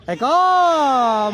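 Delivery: very slow, high, loud call of another six; drawn-out call. Real, not acted. Commentator calling yet another six, stretched out. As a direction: stretch the words as the ball sails.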